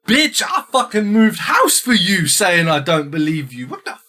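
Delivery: high, loud outraged shout; husband yelling back at his wife, mock-furious. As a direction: bellow at her, half laughing in disbelief. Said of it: "And he's like".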